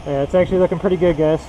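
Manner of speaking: muffled behind a full face mask; talking through a mask. Real, not acted. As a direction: voice dulled by a painting mask. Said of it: "I'm talking through a mask".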